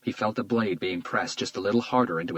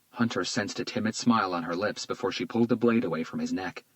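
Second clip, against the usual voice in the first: usual speaking voice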